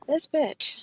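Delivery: high, hushed mock envy; jealous disbelief. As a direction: breathe it in admiring envy. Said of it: "I'm like".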